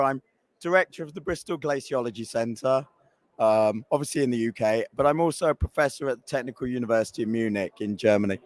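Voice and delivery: ordinary talk, nothing marked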